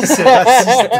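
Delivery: laughing through a mock outrage. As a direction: blurt it out while cracking up. (en riant, indignation pour rire)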